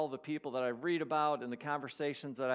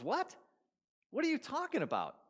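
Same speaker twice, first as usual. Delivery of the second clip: much higher, incredulous "What?"; baffled; 13 semitones higher than usual. Acted. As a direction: react like you can't believe what you heard. Barber baffled by the claim.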